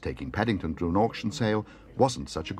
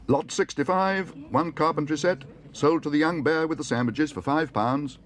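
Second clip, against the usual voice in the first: auctioneer announcing; 8 semitones higher, 3 dB louder than usual. Acted. Auctioneer announces the bear bought the set. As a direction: proclaim the lot to the hall.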